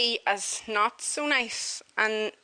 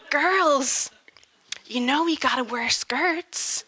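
American lady; 3 semitones higher, slower than usual. Retold or imitated. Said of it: "she was like".

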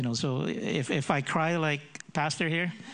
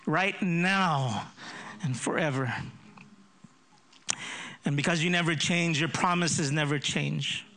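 rising prayer; 3 semitones higher than usual. Acted. Pastor praying over the congregation, building fervour. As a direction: lift the promises up in faith.

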